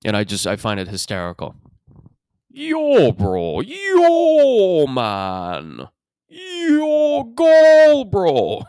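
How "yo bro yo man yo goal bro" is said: high, loud, hugely stretched Toronto vowels; exaggerated Toronto accent. Toronto guy hailing his bro, absurdly exaggerated accent. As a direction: wail each "yo" in a hammed-up Toronto accent. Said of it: "That's how they talk".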